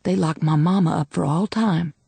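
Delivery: ordinary delivery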